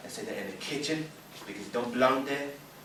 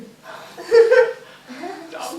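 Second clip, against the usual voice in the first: high, loud burst of laughter; a squealing laugh that breaks out; shrieking laugh; 17 dB louder than usual. Real, not acted. Laughing hard at the joke from the group.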